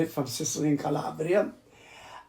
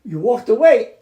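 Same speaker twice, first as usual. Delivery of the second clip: ordinary delivery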